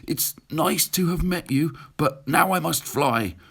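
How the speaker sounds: fast, high, stammering and nervous; the frightened dragon backs off from the beast; spluttering dragon. Acted. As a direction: gabble it out, desperate to get away. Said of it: "he spluttered".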